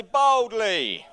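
loud, high call for the room to echo; leading a call-out. Shown as a demonstration. Pastor leading the congregation to call out, loud. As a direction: model the shout for everyone. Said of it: "Everyone say".

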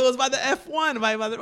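high, excited blurting; surprised, animated. Retold or imitated. Reminding his friend where the fight was, animated. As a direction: tumble over the words with excitement. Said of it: "so surprised and animated about it".

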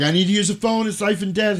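hammed-up frantic plea; urgent man. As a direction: beg for the phone in a panic. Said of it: "He's like".

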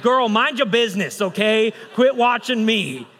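loud, high comic retort, drawn out at the end; mock ticked off. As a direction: snap back in playful indignation. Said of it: "Ah, it ticked me off".

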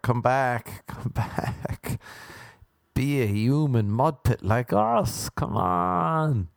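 drawn-out mock pleading, fading on the repeat; mock plea. Those left behind call after the one who left, mock pleading. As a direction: imitate people begging someone back.

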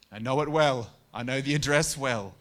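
suddenly loud, mock confession; loud joke. Real, not acted. Preacher loudly confessing to the congregation, mock rueful. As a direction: belt it out like a punchline to the church. Preacher jokes about own valley.

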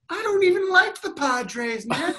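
high, innocent shrug of a line; breezy teasing heckler; mock innocence. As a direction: play it breezily unbothered. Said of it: "I was like".

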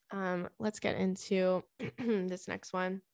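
stuffy nose, throat clearing; congested. Real, not acted. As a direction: talk through a blocked nose. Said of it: "I'm all congested".

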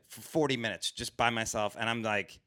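ordinary delivery

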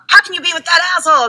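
high, fast disbelief; incredulous friend. Retold or imitated. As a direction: blurt it out in mock disbelief. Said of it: "you're like".

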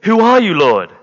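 loud, high startled cry; retold cry to heaven. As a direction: cry it out upward, falling on the last word. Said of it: "And Saul cries out".

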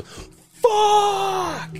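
high, drawn-out curse shouted from the back; imitated angry shout. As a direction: let the fan's frustration explode. Guy at the back yells.

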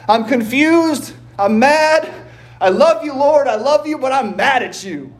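a hint of an anguished yell to the Lord; Robert Duvall shouting at God. Preacher imitating a film character yelling at God, mock shout. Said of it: "his attic shouting at God".

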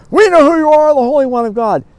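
loud, high cry of defiance; possessed man's outcry. Acted. The man walking up toward Jesus, loud possessed outcry. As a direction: shout it high at the one approaching.

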